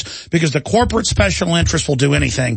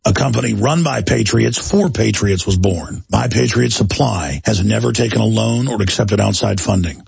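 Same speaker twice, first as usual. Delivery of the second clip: steady, lower sponsor pitch read; 7 semitones lower than usual. Acted. Host reading a sponsor ad to listeners, smooth ad-read delivery. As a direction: sell it like a radio host reading a paid ad.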